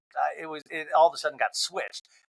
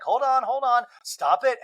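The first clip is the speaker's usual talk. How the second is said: higher, louder call to stop; Angie breaking it up; 10 semitones higher, 3 dB louder than usual. Retold or imitated. Angie stops the two women, higher and louder. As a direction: jump in and halt the fight. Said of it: "And Angie's like".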